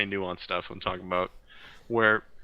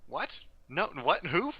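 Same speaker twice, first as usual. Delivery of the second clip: mock bewildered denial; 5 semitones higher than usual. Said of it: "most of the time they say".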